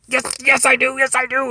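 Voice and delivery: high-pitched frantic insisting; imitating cop freaking out. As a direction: insist like an overexcited rookie. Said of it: "he's, like, freaking out".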